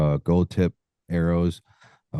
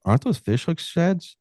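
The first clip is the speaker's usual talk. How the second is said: lightly voiced wife, pitched much higher; a quick higher imitation of the wife asking; mock wife voice, higher and curious; wife's question; 11 semitones higher than usual. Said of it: "and she goes".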